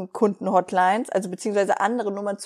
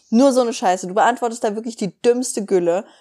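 ordinary delivery

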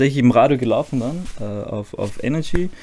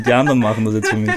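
usual speaking voice

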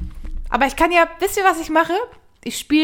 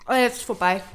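usual speaking voice

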